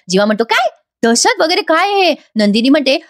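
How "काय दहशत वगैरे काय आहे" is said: high, drawn-out incredulous question; taken aback. Acted. Husband baffled by wife's fear of letters, incredulous. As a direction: react in acted disbelief, stretching the words. Jiva startled by wife's word terror.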